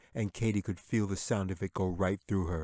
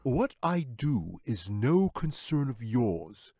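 higher, drawn-out lordly wizard; haughty wizard; 4 semitones higher, 6 dB louder, slower than usual. A narrator's reading. Wizard to the bear, haughty and slow. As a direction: put the bear in his place, smugly.

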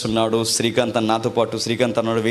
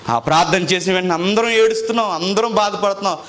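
impassioned preaching; 8 semitones higher, 3 dB louder than usual. Acted. Emotional testimony continues.

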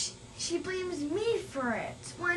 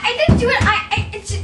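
protesting while cracking up; laughing denial; 6 semitones higher, 10 dB louder, faster than usual. Acted. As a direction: deny it through laughter.

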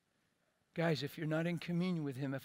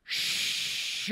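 shush; 7 dB louder than usual. Retold or imitated. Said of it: "he goes".

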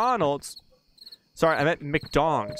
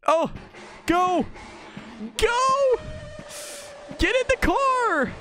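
very high frantic shout; panicked yelling; slower than usual. Real, not acted. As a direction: yell it while running for your life. Fleeing to the car.

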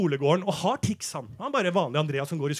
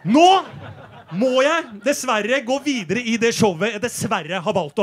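raised, insistent voice; loud announcement; 5 semitones higher, 8 dB louder than usual. Acted. Comedian forced to go on with the show, loud exasperated announcing. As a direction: push on loudly with the show.

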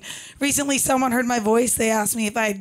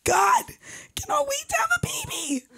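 put-on high-pitched squealing baby fever; exaggerated squeal, voice shooting up; mock freak-out; 10 semitones higher than usual. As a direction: gush hysterically like a baby-crazy friend. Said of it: "they freak out".

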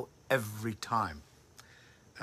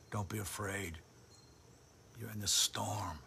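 soft reassurance; 3 semitones lower, 10 dB quieter than usual. Acted. Preacher repeats Jesus softly.

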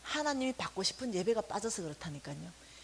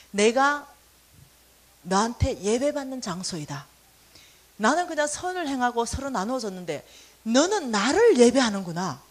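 God's voice; 8 dB louder than usual. Retold or imitated. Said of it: "voicing God".

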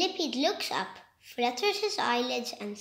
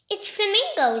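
proud reply; 7 semitones higher, 5 dB louder than usual. Acted. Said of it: "the millipede replies".

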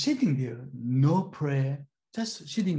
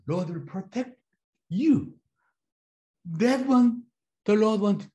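higher, louder preaching burst; preaching emphasis; 7 semitones higher, 4 dB louder than usual. Real, not acted. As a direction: proclaim God's protection. Preaching climax about protection.

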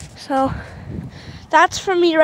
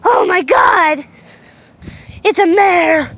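slow, loud, higher mock-excited shout; excited stud; 5 semitones higher, 7 dB louder, slower than usual. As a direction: ham up a horse going wild over a mare. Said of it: "this stud is not like regular stud where he's like".

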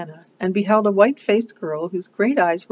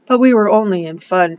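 a mildly insistent plea, louder; persisting; 3 semitones higher, 8 dB louder than usual. A narrator's reading. Anne insistently pleading with the old aunt. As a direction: keep pleading earnestly. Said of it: "persisted Anne".

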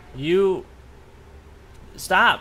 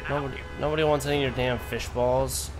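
ordinary talk

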